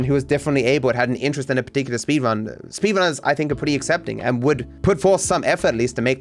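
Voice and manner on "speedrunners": ordinary talk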